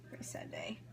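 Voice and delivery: mock sad whisper. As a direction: breathe it out as a joke lament over onion tears. Onion tears, mock grief.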